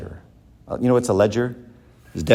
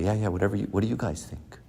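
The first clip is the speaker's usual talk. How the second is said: softer, fading humble mumble, head down; mock humble; 8 dB quieter, faster than usual. Professor among the legends, turning meek and quiet. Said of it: "put my head down and say".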